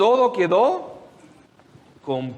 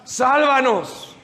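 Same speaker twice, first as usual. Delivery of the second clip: desperate plea; 6 semitones higher, much slower than usual. Acted. Disciples pleading for rescue.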